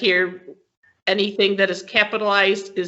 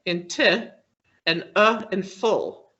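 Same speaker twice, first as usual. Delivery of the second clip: usual speaking voice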